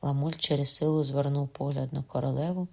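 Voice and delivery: plain reading voice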